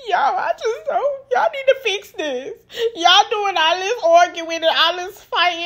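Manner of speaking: high, teary, slowed voice breaking into sobs; mock-sob the plea like a tearful pregnant woman; imitating crying. Pregnant Rennie pleading with fighting friends, imitated crying. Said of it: "poor Rennie just bust out".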